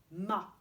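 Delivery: "ma" said loud and detached, open a; syllable demo. Shown as a demonstration. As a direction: set "ma" apart as one clear syllable.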